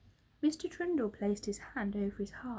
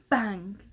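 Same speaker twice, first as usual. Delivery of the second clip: gunshot bang; 6 dB louder than usual. A narrator's reading. Gunshot sound effect.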